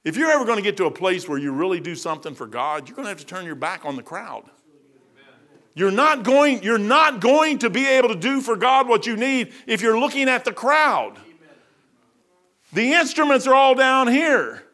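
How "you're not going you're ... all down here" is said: loud, high preacher's cry; preaching at full force. Acted. Preacher rousing the congregation, loud and high. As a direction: lift the church with it.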